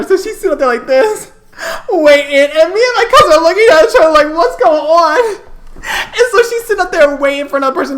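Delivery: high, loud, laughter-strained storytelling; laughing while telling. Real, not acted. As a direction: push the story out through hysterics. Teller cracking up through the punchline.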